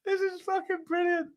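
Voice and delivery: high, helpless laughing voice; real giggling disbelief; cracking up. Real, not acted. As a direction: gasp it out between fits of laughter. Cracking up at the impression.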